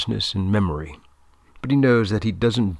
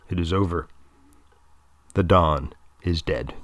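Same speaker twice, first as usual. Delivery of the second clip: ordinary delivery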